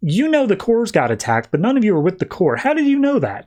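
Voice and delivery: high-pitched pointed questioning; suspicious challenge. Retold or imitated. Carol confronting Guardians about the Corps, suspicious.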